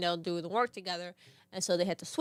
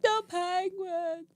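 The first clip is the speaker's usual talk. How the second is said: put-on very slow, high sobbing child; crying toddler; 9 semitones higher, much slower than usual. Toddler brother watching penguins, slow high sob. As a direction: draw it out through tears like a small boy. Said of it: "he's crying hysterically".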